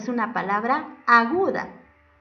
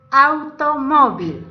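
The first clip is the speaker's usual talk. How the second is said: "Automóvil" slow, loud and higher, split into syllables; 5 semitones higher, 9 dB louder, slower than usual. Shown as a demonstration. Teacher presenting the next example word, "Automóvil" loudly divided into syllables. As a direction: punch out "Automóvil" slowly, syllable by syllable. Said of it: "Tiene cuatro sílabas".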